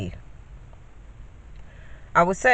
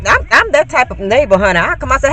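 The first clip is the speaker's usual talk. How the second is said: ordinary talk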